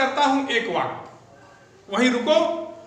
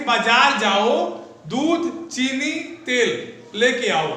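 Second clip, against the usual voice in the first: each of दूध, चीनी, तेल set apart by a short pause; pauses between list items; slower than usual. Shown as a demonstration. Teacher demonstrating commas to the class, pausing after each item. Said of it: "दूध पे रुकना पड़ेगा".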